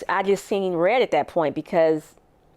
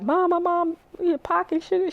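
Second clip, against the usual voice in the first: urgent call; 12 semitones higher than usual. Retold or imitated. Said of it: "I'm like".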